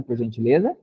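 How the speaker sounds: ordinary talk, nothing marked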